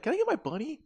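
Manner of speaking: put-on squeaky kid begging for her bunny; little girl voice. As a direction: play the pleading little girl. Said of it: "The little girl's like".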